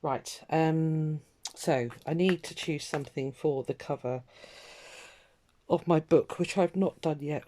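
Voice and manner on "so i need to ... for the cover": ordinary talk, nothing marked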